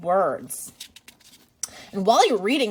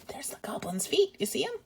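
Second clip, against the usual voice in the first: whisper; 3 semitones higher, 8 dB quieter, faster than usual. Real, not acted. Teacher whispers to the children about the hidden goblin. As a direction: lean in and whisper a secret. Pointing out picture secretly.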